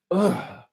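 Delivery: loud disgusted grunt; acted waking-up groan; groggy groan. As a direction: groan as if dragged awake.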